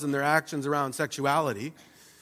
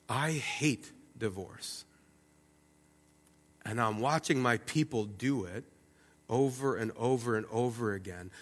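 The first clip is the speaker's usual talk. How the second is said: quiet grieving God; 4 semitones lower, 7 dB quieter than usual. Acted. God voiced sorrowfully, quiet.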